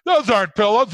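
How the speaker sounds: loud, high quoting of the pillow line; movie quote impression. Buddy quoting the movie to his cohost, mildly hammed-up. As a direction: ham up the famous movie line. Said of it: "what's that movie with John Candy".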